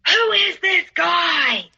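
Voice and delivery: high, drawn-out accusing shout; retold angry scream. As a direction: scream it slowly, word by word. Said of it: "he starts screaming at me".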